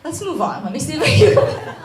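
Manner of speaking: high voice breaking into a tearful laugh; crying and laughing. Real, not acted. Speaker trying to carry on before audience, crying. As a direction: try to move on through tears. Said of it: "Why am I crying so much?".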